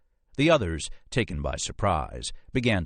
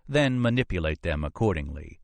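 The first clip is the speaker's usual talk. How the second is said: ordinary delivery, nothing marked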